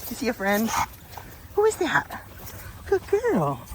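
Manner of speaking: soft, high teasing coo; cooing dog-talk. Real, not acted. Cooing to dog seeing a friend.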